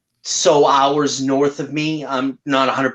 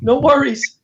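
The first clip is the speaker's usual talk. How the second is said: mock reassuring agreement; 12 semitones higher than usual. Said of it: "I'm like".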